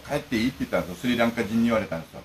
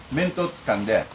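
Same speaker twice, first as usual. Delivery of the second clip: ordinary delivery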